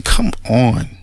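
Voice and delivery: normal talking voice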